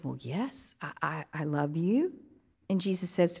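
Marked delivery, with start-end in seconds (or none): 0.0-2.1 hesitant quiet answer. Acted. Peter answers Jesus, ashamed.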